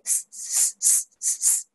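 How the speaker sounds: short unvoiced "スッ" puffs of breath; "スッ" as a thin, steady s hiss with no vowel; steady hiss bursts. Shown as a demonstration. Teacher showing students an unwavering exhale, "スッ" as short even hisses. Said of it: "ブレないで".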